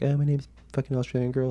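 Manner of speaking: high-pitched mock girl's voice; Australian girl. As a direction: imitate her in a put-on high voice. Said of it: "And she's like".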